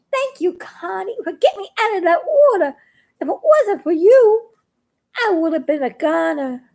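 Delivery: high, quick thankful voice; lightly voiced little frog, relieved; grateful frog. Little frog thanking Connie for the rescue.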